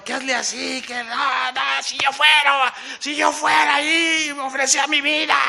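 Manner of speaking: high, strained heckling; imitated crowd yelling. Spectators telling the bullfighter what they would do, yelling. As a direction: mimic spectators yelling boasts over each other. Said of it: "Pero el gritadero".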